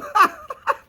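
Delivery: high, loud giggle; real laugh after a silly confession; high burst of laughter. Real, not acted. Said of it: "crying by myself".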